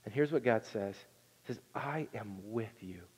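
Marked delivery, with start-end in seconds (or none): 1.7-3.0 soft reverent quote. Acted. God's promise, voiced softly.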